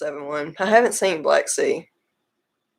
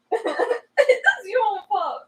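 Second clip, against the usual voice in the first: high, drawn-out teary laugh; laughing to tears; much slower than usual. Real, not acted. Blaming the partner after the stare down, laughing to tears. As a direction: squeeze it out slowly through laughter. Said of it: "That made me cry".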